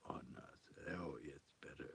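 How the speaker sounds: soft unvoiced whisper. Real, not acted. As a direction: whisper it close to the mic. Testing new microphone, whispering.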